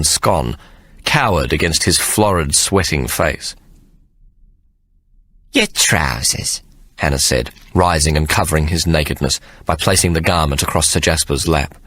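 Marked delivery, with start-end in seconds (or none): 5.5-6.6 low, slow nanny voice; dry matter-of-fact. A narrator's reading. Hannah returning Sir Jasper's trousers, low and slow. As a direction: hand them over coolly.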